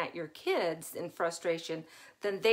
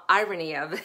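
normal talking voice

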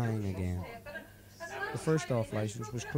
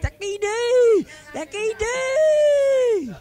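slow, much higher and louder howl after a laugh; 14 dB louder, much slower than usual. Acted. Paddy howling his catchphrase at his friend, crazed howl. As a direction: whoop it like a madman.

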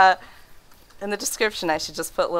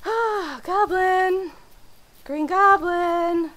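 1 high startled cry; 10 semitones higher, much slower than usual. Real, not acted. Goblin appears, cries out.

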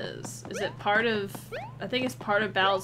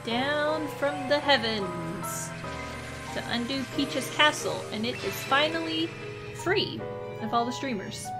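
dramatic narration; 5 semitones higher than usual. Acted. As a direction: narrate it grandly.

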